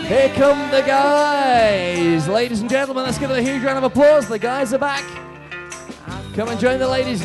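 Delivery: loud, high announcer over music; upbeat cruise director. Acted. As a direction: whip up the crowd like a host.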